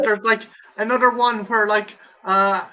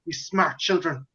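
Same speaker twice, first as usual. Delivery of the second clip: Donald Pleasance impression; 7 semitones lower than usual. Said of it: "Donald Pleasance is like".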